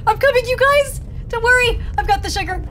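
heroic call-out. Real, not acted. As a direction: rush in to save the team. Calling out to team.

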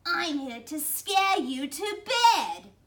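slow, high, loud monster threat; loud, indignant creature outburst; rattled loudly. A narrator's reading. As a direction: raise it to a drawn-out monster shout. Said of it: "Cynthia rattled loudly".

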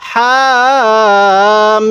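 হামিম with মিম drawn out slowly; chanted হামিম as in recitation; four-alif elongation. Shown as a demonstration. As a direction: hold the মিম in হামিম long. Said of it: "মিমের মধ্যে চার আলিফ".

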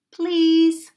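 high, loud, held "please"; mouse's please. Acted. Little mouse turns around and answers, high please.

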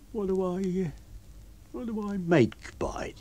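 high, hesitant wheedle; wheedling tramp. A narrator's reading. Tramp trying another tack on his master, wheedling. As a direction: ask haltingly in a high voice.

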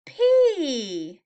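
ordinary delivery, nothing marked

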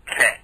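แขะ with a short vowel and low tone; corrected pronunciation. Shown as a demonstration. Teaching the co-host the right reading, careful pronunciation. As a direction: say แขะ short, low-toned, as spelt with ขอไข่. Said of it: "เขาจะอ่านว่า".

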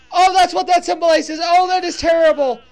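loud, crying out in dismay; anguished realization, voice breaking; distressed cry. Real, not acted. Realizing the tragic meaning.

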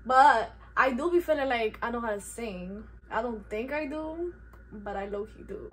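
ordinary talk

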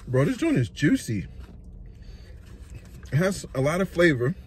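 talking between bites; chewing while talking. Real, not acted. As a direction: mouth partly full of food. Seen 1: Bit sandwich at -5; mouth works between words; sandwich held later.

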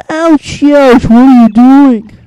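loud, slow pained cry of a big fish; voiced tuna yelling. Tuna shouting at Mario in pain, loud and slow. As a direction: howl it out like a hurt tuna.

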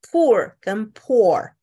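poor then poor, first with a long oo, then with an open aw; two vowels, oo versus aw; slow contrast. Shown as a demonstration. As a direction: slowly set the two poor sounds apart. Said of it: "不一样哈".

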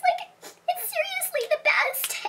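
squealed up high in rapture; breathless high gush. Acted. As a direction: swoon over the perfume. Gushing over discontinued perfume.

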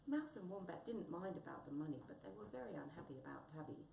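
plain reading voice

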